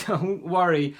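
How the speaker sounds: laughing. Acted. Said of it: "laughed Jonathan".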